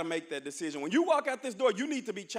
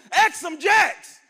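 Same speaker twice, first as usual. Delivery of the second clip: slow, drawn-out, high shout of "Ask 'em Jacks"; shouted motto; 9 semitones higher, 15 dB louder, much slower than usual. Shown as a demonstration. Speaker to the freshmen, demonstrating the school motto, slow big shout. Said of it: "I'm going to motto what I want you to do".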